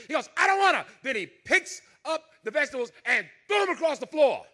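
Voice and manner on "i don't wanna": put-on higher, louder bratty child refusing; whiny kid. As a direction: whine it like a spoiled boy.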